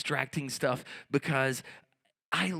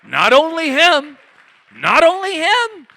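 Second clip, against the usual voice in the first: loud, much higher, shouted twice; outraged yelling; 13 semitones higher, 15 dB louder than usual. Real, not acted. Comedian bellows in disbelief at the crowd about politics. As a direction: howl it in disbelief, climbing high.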